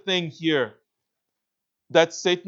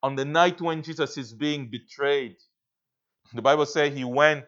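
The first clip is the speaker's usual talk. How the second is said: ordinary talk, nothing marked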